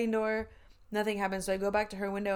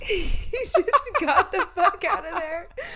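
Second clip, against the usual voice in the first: high, loud laugh through the line; cracking up at the punchline; 11 semitones higher, 7 dB louder than usual. Real, not acted. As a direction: burst out laughing while telling it. Laughing at the story.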